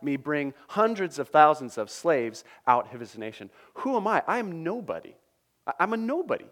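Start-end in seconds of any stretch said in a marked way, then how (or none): 3.7-6.5 higher, pleading voice of a man out of his depth; a hint of overwhelmed Moses; panicked Moses. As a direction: protest like a shepherd who feels too small. Moses protests his calling.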